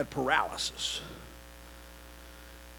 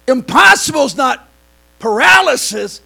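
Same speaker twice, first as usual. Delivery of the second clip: much louder, higher proclaiming; emphatic declaration; 10 semitones higher, 17 dB louder than usual. Real, not acted. Preacher proclaims to the church, loud. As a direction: proclaim it over the congregation.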